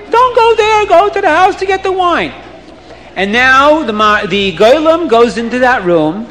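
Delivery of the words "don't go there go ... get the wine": high, urgent shouted warning; screaming crowd. Acted. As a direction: yell to stop him. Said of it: "people start screaming".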